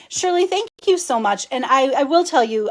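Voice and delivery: ordinary talk, nothing marked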